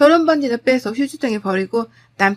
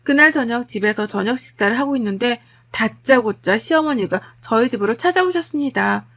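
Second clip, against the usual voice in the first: ordinary delivery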